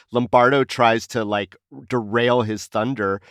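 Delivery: normal talking voice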